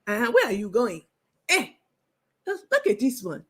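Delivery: mock African braider voice, lightly done; a hint of an African auntie braider; African accent. Braider scolding a customer in a light African accent. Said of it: "my African voice is on point".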